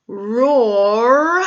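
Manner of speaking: ordinary delivery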